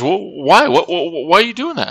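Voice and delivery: high, startled alarmed voice; voicing a startled friend, well above own pitch. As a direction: pitch way up, panicky and confused. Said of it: "And he goes".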